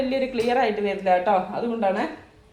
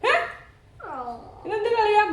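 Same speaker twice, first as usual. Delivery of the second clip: playful mock scolding; 7 semitones higher than usual. Real, not acted. Mock scolding her playing child. (കുഞ്ഞിനെ കളിയായി ശാസിച്ച്)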